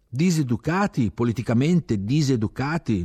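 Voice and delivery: loud, insistent repeat of diseducati, falling; emphatic repeat. Acted. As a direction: hammer home diseducati for the one who didn't catch it. Repeats the word when asked.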